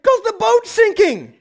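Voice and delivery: high, slow, laughing incredulity; mock-baffled laughing retort; laughing disbelief. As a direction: laugh it off as obvious. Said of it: "'Cause I'm like".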